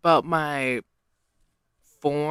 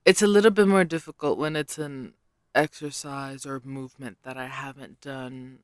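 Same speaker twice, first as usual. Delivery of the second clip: ordinary talk, nothing marked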